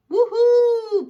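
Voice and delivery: high, loud cheer of joy; kid's thrilled woohoo; excited shout. A narrator's reading. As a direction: whoop it out on a bumpy ride. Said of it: "Pete shouts".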